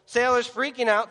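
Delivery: usual speaking voice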